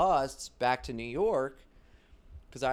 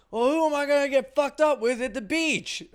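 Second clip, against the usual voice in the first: much higher, louder, laughing dumb-guy voice; imitating buddy; 11 dB louder than usual. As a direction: play the clueless party friend. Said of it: "He goes".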